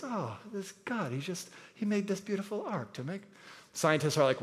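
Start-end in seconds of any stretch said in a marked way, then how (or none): 0.0-3.2 softer, higher sing-song gushing; mock sentimental sing-song, much softer; sing-songy voice. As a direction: coo over the rainbow like a sweet children's lesson. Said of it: "you kinda get that sing-songy voice".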